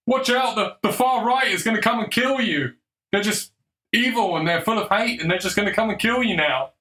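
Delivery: high, hammed-up alarmist voice; high hammed-up panic voice; mock media scaremonger. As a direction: parody the fearmongering news. Mocking mainstream media scaremongering.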